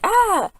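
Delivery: one very high "ah" scream; mock scream. Amy after the driver runs away, high mock scream. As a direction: imitate a short high-pitched shriek. Retold scream at scary man.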